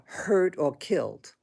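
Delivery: ordinary talk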